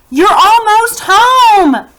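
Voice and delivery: high, loud, slow encouraging shout; strong call. Acted. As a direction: call strong as a tow rope. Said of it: "Grandpa's voice was as strong as a tow rope".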